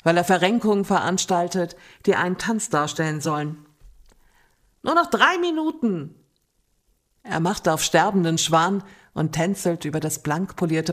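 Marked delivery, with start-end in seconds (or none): 4.8-6.1 put-on pleading drunk. Drunk friend begging to stay.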